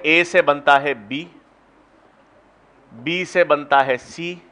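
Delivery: slow, spaced out, each link stressed on से; slow step-by-step dictation. Shown as a demonstration. Teacher to students laying out a pathway, slow and deliberate. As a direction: spell out the pathway slowly for notes. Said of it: "ये उपापचय पथ है।".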